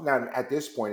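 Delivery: ordinary talk, nothing marked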